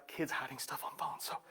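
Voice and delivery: "Kids hiding stuff on phones" in a soft unvoiced whisper; secretive whisper. Shown as a demonstration.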